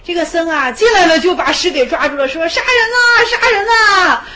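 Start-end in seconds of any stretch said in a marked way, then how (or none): 2.5-4.2 high, loud panicked yell; crying murder. Retold or imitated. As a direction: shout for help as if being attacked. Monk grabs hermit, who cries murder. (惊慌地高声喊“杀人”)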